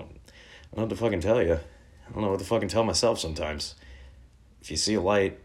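usual speaking voice

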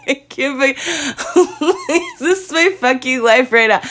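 high, helpless laughter through the words; hysterical laughing. Real, not acted. Woman retelling her despair over vet costs, hysterical laughing. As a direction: crack up while retelling the despair. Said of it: "I shouldn't be laughing".

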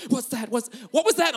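imitating a startled light sleeper; startled wife. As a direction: jolt awake with an exaggerated gasp. Wife startled awake by rustling.

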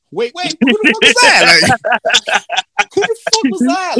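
high, loud, breaking into a laugh; God waking up alarmed; startled shout. Retold or imitated. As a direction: yelp of surprise.

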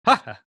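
forced, hammed-up chuckle; ridiculous put-on little laugh; fake chuckle. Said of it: "hit me with that chuckle".

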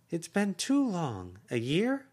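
voiced aunt, high and urging; effusive aunt. Aunt greets niece.

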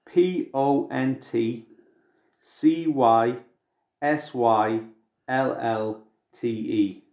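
spelling out each letter slowly; slow, for someone writing it down. Shown as a demonstration. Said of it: "Write this down or type it straight in".

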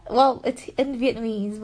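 usual speaking voice

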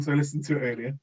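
usual speaking voice

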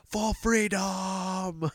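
long drawn-out, high-pitched mock Scottish battle cry; Scottish accent. Shown as a demonstration. Showing off his best Scottish accent, drawn-out battle cry. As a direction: stretch out a rallying cry in hammed-up Scottish. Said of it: "I can do the best Scottish accent".

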